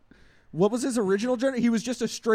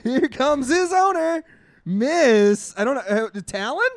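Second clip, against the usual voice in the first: slow, higher, louder entrance call; ring announcer; 5 semitones higher, 6 dB louder, slower than usual. Pretend.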